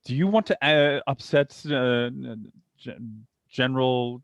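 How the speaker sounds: put-on British accent. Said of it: "putting on the British accent".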